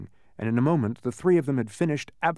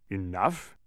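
mildly impatient echo; 3 semitones lower than usual. A narrator's reading. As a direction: throw the word back with slight impatience. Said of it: "he said impatiently".

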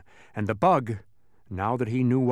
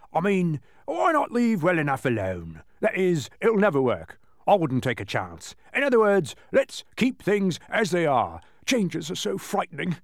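lightly voiced nervous giant, pitched up; mildly anxious, higher character voice; timid giant, fretting; 6 semitones higher, 3 dB louder than usual. A narrator's reading. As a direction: fret and backpedal as a fearful giant.

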